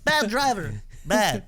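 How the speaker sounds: high alien driver voice; road rage. Acted. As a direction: scold like a tiny alien. Mocking a driver in a voice.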